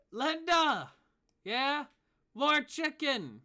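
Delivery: high, loud, slow holler; calling out. Acted. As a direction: shout it across the inn.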